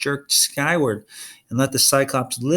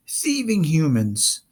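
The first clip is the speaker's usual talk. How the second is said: slow, raised monster shout; shouting Cyclops; 4 semitones higher, slower than usual. Acted. Polyphemus shouts at Tyson, slow and raised. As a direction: spit the accusation slowly. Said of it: "Polyphemus shouted".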